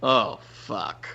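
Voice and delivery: low, stunned muttered curse; acted open-mouthed disbelief, pitched well down. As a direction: let it drop out low, as if dumbstruck. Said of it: "my mouth is wide open".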